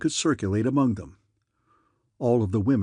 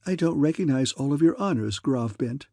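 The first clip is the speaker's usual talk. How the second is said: ordinary delivery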